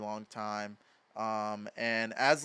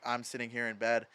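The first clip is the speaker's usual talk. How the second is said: usual speaking voice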